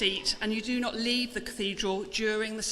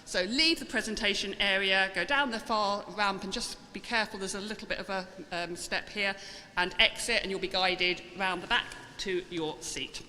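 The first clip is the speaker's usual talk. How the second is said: ordinary delivery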